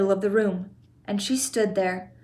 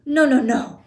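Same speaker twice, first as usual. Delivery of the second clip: louder, urgent no; alarmed cry; 4 semitones higher, 6 dB louder than usual. A narrator's reading. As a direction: try to stop him, alarmed. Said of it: "cried Mister Wonka".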